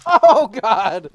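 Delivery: loud, high cry of alarm; startled yell. Real, not acted. Player caught off guard in the game, startled yell. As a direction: blurt it out at full volume.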